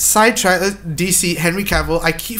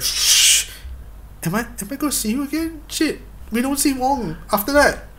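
high, soft, tentative mock concern; mock worry; 7 semitones higher, 6 dB quieter than usual. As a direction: play a small anxious fan, hushed and high. Acts out worry over missing Wong.

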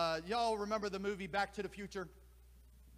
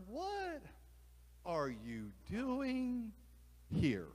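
a lightly voiced owl, slow and wondering; owl asking; 3 dB quieter, much slower than usual. Owl questions the out-of-place bird, lightly voiced wonder. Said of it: "The owl asked".

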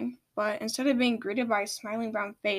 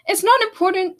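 sharp interruption; 8 semitones higher, 10 dB louder than usual. Acted. Seashell cuts off question.

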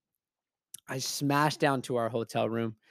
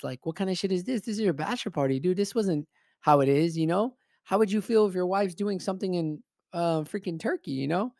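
higher-pitched, exasperated challenge; indignant confrontation; 5 semitones higher than usual. Retold or imitated. Friend confronts groom at bachelor party, indignant. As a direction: press him in rising exasperation.

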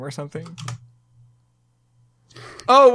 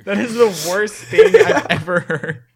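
laughing; 5 semitones higher, 8 dB louder, slower than usual. Real, not acted. Laughing disgust at friend's line.

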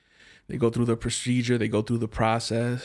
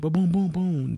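higher, punchy boom boom; quick sound effect of things dropping; mock banging noises; 6 semitones higher than usual. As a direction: make the clatter with your mouth. Imitating dumping noise.

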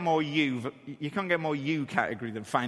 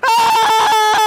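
much higher, much louder scream; 14 dB louder than usual. Retold or imitated. Dad screaming while the shell spins, shrieking. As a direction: let out a high terrified shriek.

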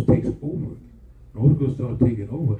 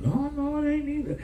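full-force preaching; 4 dB quieter than usual. Acted. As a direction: preach it to the rafters.

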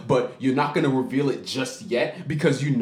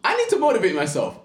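high, fast self-pep talk; hammed-up burst of motivation; mock pep talk; 12 semitones higher, 4 dB louder, faster than usual. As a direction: pump yourself up in a squeaky voice. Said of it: "and I'm like".

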